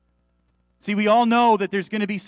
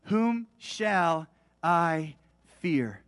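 very slow, word by word with pauses; slow, weighty question; much slower than usual. Real, not acted. Preacher posing the key question to the church, slow and weighty. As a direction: draw out each word solemnly.

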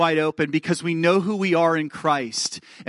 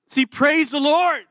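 exultant praise; 10 semitones higher, 4 dB louder, slower than usual. Real, not acted.

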